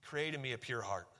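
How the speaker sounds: hushed, prayerful echo of the verse; soft prayerful repeat. Shown as a demonstration. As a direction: pray "Create in me a pure heart" softly to God. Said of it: "That should be our prayer".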